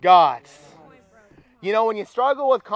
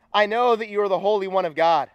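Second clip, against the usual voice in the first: ordinary talk, nothing marked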